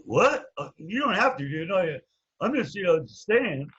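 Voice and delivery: retold startled "what", high and loud, then backing off; startled backpedalling. Quoting brother taken aback by decision.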